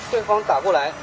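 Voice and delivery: ordinary talk, nothing marked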